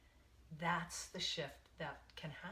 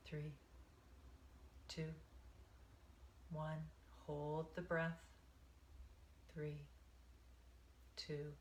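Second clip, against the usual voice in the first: guided meditation. Acted. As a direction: ease them into the breath, one count at a time.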